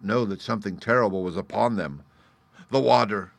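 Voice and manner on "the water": higher, louder cry of alarm, rising on the repeat; alarmed gasp. A narrator's reading. Young man warning everyone of the rising flood, alarmed cry. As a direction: cry out the warning in mounting panic. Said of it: "gasped one of the young men".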